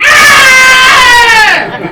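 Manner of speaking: long, very high, loud scream; hammed-up howl while being stretched; hollering in pain. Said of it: "he's hollering".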